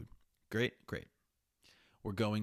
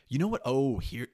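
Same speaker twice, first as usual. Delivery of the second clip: loud, higher sudden exclamation; sudden outburst; 3 semitones higher, 9 dB louder than usual. Real, not acted. Suddenly remembering a topic, loud outburst. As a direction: blurt it out.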